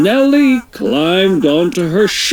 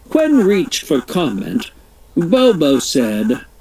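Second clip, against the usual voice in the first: ordinary delivery, nothing marked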